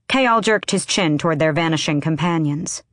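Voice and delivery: usual speaking voice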